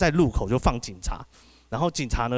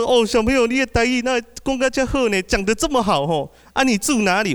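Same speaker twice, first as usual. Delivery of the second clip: high, louder cooing auntie asking where he lives; auntie's sweet praise; 9 semitones higher, 4 dB louder than usual. Retold or imitated. Auntie on the bus charmed by the boy, high cooing voice. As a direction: gush over the kid like a smitten auntie. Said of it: "那个阿姨就说".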